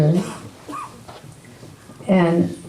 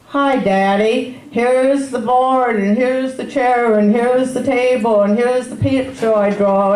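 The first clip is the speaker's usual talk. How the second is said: put-on higher, slowed sing-out of a proud child; lightly voiced little boy, pitched up; chattering little boy; 5 semitones higher than usual. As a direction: imitate a small child showing Daddy round. Quotes her chatty little son.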